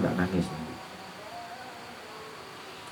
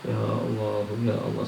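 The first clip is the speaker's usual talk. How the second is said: slow, soft, drawn-out Ya Allah, lightly crying; soft pleading prayer; 3 dB quieter, slower than usual. Shown as a demonstration. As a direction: plead to God quietly, stretching each Allah. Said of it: "ketika kita nyebut namanya Allah".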